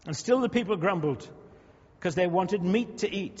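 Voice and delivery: ordinary talk, nothing marked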